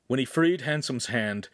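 plain reading voice

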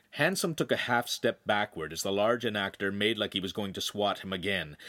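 ordinary delivery

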